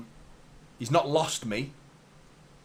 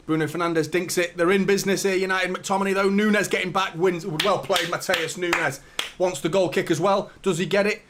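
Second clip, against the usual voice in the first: live play-by-play; 4 semitones higher, 4 dB louder than usual. Acted. Live commentary on the match.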